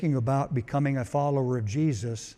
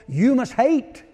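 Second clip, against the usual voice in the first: higher, louder, punched; emphatic declaration; 7 semitones higher, 6 dB louder than usual. Acted. Preacher shocking the congregation, loud and high. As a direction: drop it like a bombshell.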